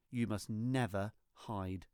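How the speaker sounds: ordinary delivery, nothing marked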